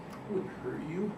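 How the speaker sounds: normal talking voice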